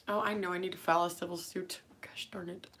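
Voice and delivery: breathy whisper under her breath; quick, fast whispered mutter; whispered aside. Real, not acted. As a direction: drop to a whisper, talking to yourself.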